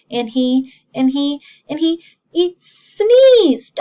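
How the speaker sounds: stammering build that bursts out loud; building to a sneeze. Acted. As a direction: wind up the sneeze for the kids.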